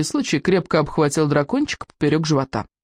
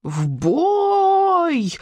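much higher joyful cry; happy battle yell; 12 semitones higher than usual. A narrator's reading. Little dragon yells happily charging the herd, much higher. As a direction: whoop with glee. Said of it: "счастливо заорал Беззубик".

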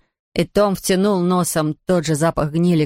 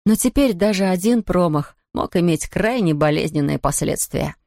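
ordinary delivery

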